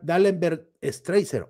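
ordinary talk, nothing marked

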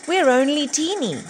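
squeaky small voice. A narrator's reading. Driver pleading with the troll, squeaky. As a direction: sound small and harmless.

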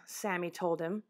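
ordinary delivery, nothing marked

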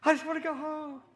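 put-on high, loud whiny child voice; imitated boy's high whine; boy screaming. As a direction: wail it like a scared little boy. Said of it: "He's screaming".

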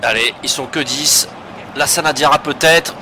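live match commentary, building energy; play-by-play commentary. Acted. Live commentary on his own match.

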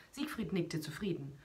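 ordinary delivery, nothing marked

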